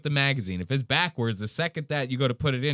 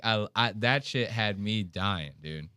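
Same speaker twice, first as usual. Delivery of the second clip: ordinary talk, nothing marked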